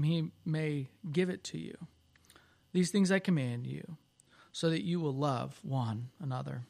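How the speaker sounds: soft, reverent prayer voice. Acted. Scripture reading and closing prayer.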